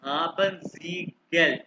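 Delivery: हाबन सी गल drawn out word by word; slow model phrase. Shown as a demonstration. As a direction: say हाबन सी गल slowly with clear gaps, for a student. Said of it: "अभी सिर्फ इसको सुनो".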